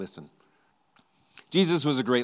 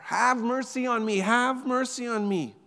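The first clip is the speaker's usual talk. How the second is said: crying out louder; 11 semitones higher than usual. Retold or imitated. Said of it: "the man cried out louder".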